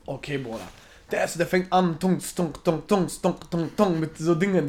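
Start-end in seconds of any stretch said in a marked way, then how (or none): 2.0-4.0 punchy drum beats made with the voice; imitated darbuka drum. Wedding joke patient mimes playing darbuka, imitated drum beats. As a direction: tap out a spoken percussion rhythm. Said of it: "Darbuka nennt sich das so".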